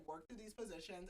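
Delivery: ordinary delivery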